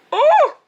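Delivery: high loud oh of dismay; dismayed cry. Real, not acted. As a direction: yelp in dismay. Dismay at cost.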